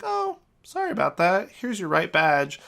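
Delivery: high-pitched, airy brush-off; mock unconcerned apology. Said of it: "they didn't seem like they cared".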